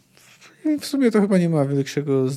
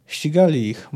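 ordinary delivery

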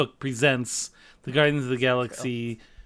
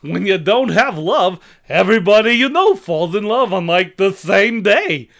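loud high giggly comic voice; Ed Wynn; 4 semitones higher, 7 dB louder than usual. Acted. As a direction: trill it out like Ed Wynn. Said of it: "it's gonna be Ed Wynn".

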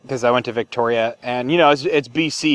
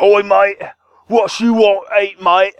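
imitating pub staff, louder, British accent; hammed-up British pub greeting; 5 semitones higher, 4 dB louder, slower than usual. Copying the English pub staff in Victoria, British accent. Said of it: "English pubs and everyone's like".

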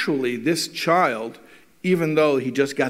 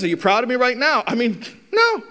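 mocking retort; 7 semitones higher than usual. Skeptical parent mocking the son's question, scornful and fast. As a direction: throw the kid's question back with a sneer. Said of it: "And he says".

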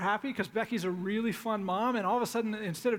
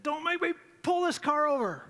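higher, louder dad threat shouted over the seat; retold yell; 7 semitones higher, 5 dB louder than usual. Frustrated dad yelling at fighting boys in the back seat. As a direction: bark it like a fed-up dad at the kids. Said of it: "I'm yelling at 'em".

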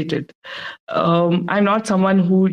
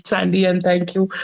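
ordinary talk, nothing marked

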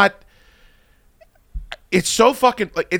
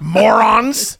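a louder, higher scoffing jab; mock scornful insult; 7 semitones higher, 5 dB louder than usual.

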